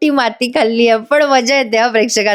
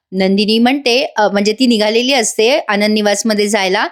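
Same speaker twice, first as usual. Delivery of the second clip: usual speaking voice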